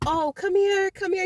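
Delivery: mock baby-talk calling an animal; coaxing a pet. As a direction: coax it like luring a scared cat.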